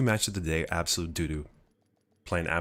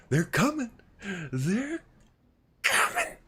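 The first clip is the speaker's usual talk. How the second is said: high, loud, squealing hype; excited; 14 semitones higher, 5 dB louder, slower than usual. Real, not acted. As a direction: burst out with real excitement, pitch soaring. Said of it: "I'm excited".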